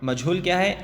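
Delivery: normal talking voice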